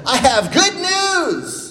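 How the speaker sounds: high, slow yell of a herald. Shown as a demonstration. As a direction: holler it out like a herald. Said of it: "Can you be a yeller and say".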